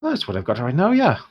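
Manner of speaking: lightly Irish dwarf trader, lifted openings; haggling dwarf shopkeeper. Acted. Dwarf quotes heroes his whole stock, lightly voiced and protesting. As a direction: haggle as a put-upon dwarf.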